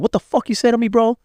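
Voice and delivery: high-pitched, confrontational retort; retold hostile challenge. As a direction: act out squaring up to someone, pitch jumping up. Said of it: "I was very hostile over there".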